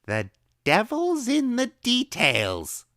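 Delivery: very high, slow, drawn-out proclamation; gleeful secret. A narrator's reading. Old woman gleefully telling the boy a secret. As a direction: savour it like a secret.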